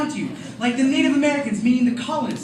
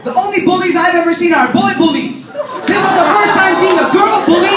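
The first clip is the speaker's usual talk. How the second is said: louder, higher triumphant shout; loud kid declaration; 5 semitones higher, 7 dB louder than usual. Retold or imitated. Brother to cousin, loud triumphant declaration. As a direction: deliver the final blow proudly. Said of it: "he goes".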